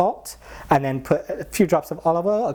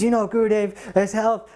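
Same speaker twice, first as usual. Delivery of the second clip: higher, fussing at the devotees; mock scolding; 9 semitones higher than usual. Maharaj chastises devotees about guru's health, high fussy scold. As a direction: lecture them, fussy.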